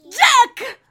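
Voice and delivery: high, loud cry of the name; alarmed shout. Acted. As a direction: yell it in sudden alarm. Spotting the returning thief. (grido acuto d'allarme)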